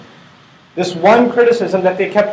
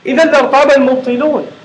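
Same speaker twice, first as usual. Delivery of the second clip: Quran recitation; 4 semitones higher, much faster than usual. Acted.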